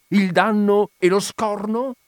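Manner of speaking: high, loud, slow indignant cry; a landowner's slow, high, outraged demand; fists-clenched outrage. Acted. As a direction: spit it out through clenched fists. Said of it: "con le pugna serrate".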